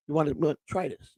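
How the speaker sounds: softly murmured salesman come-on; imitating a sly, charming salesman; greasy salesman charm. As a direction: sidle up quietly with the pitch. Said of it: "That's what, how he talked".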